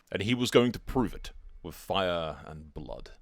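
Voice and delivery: measured, solemn storyteller delivery; grand fantasy prologue narrator; epic story narration. A narrator's reading. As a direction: recount it like a chronicle of war.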